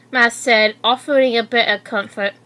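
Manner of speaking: ordinary delivery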